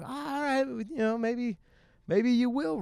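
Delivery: soft, high-pitched musing; tentative self-talk. Retold or imitated. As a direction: quietly talk yourself round, voice up high. Acts out own hesitant self-talk.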